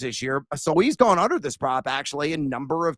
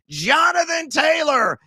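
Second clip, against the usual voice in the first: hyped announcer call; 10 semitones higher, slower than usual. Acted. Hyping player name on show.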